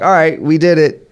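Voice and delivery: mock triumphant cheer; smug mock celebration. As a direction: play the complacent winner patting himself on the back. Mock self-satisfied victory line.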